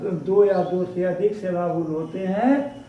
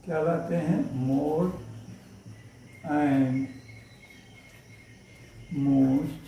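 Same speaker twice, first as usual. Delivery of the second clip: slow, lower, spelled-out dictation pace; 5 semitones lower, 9 dB quieter, much slower than usual. Shown as a demonstration. Teacher dictating the rule to students, slow and measured. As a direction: read it out slowly so students can write.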